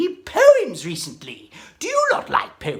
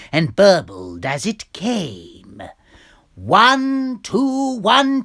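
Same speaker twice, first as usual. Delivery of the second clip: dramatic poem reading; 3 semitones lower, 3 dB louder than usual. Acted. Reciting Jabberwocky.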